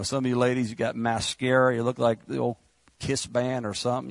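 ordinary talk, nothing marked

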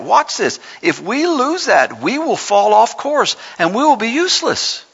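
high-pitched, forceful sermon delivery; impassioned preaching. Real, not acted. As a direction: preach it up high, driving each point home. Preacher builds to hard charge.

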